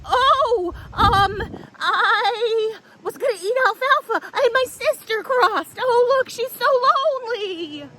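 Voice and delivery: high, fluttery little goat voice; high pleading goat. Acted. Tilly begging the troll not to eat her, high and fluttery. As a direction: plead like a nervous small goat.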